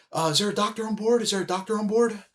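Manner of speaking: high-pitched intercom call for a doctor; flight attendant announcement. Retold or imitated. Flight attendant on the intercom asks passengers for a doctor, high and urgent.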